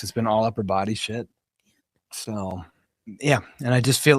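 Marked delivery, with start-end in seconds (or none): 0.0-2.6 unvoiced whispered aside; whispering. Real, not acted. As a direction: drop to a whisper. Whispered aside about gym.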